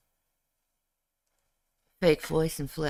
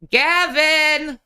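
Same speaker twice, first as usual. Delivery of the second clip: loud call across the house; 9 semitones higher, 9 dB louder, much slower than usual. Real, not acted. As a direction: call the cat loudly. Calling the cat loudly.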